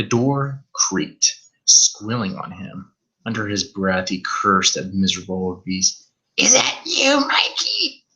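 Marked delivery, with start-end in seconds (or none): 6.4-8.0 put-on high, grating old mother; buzzsaw mother voice. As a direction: screech it like a buzzsaw. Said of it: "Her voice was like a buzzsaw".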